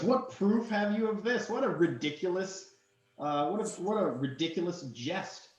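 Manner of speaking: high, scoffing royal voice; indignant prince. Acted. Prince to the party about his bride, high and scoffing. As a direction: scoff at the ridiculous claim. Prince mocks, then demands answers.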